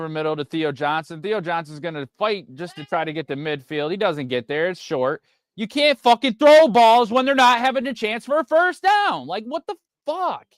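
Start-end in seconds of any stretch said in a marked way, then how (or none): 5.5-10.5 much higher, loud furious rant; angry rant. Real, not acted. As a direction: vent at the quarterback, exasperated. Fan raging at a bad throw.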